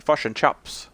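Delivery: quick Kiwi accent demo; "Fish" said as "fush" with a light New Zealand lilt. Shown as a demonstration.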